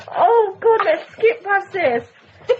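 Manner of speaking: laughing. Real, not acted. Co-host laughing, embarrassed.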